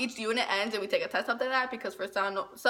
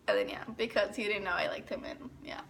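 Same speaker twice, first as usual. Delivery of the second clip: unvoiced whisper; 3 semitones lower, 8 dB quieter than usual. Real, not acted. Girl whispering that he never knew she liked him. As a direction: confide it like a secret. Confiding secret crush.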